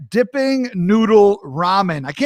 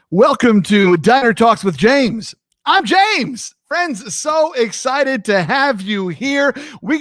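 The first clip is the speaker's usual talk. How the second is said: show intro; 6 semitones higher, 3 dB louder, slower than usual. Acted. Opening the show as host.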